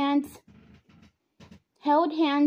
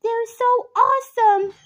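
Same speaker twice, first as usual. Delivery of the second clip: excited; 9 semitones higher, 5 dB louder than usual. A narrator's reading. Girl cheering skaters.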